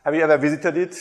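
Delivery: usual speaking voice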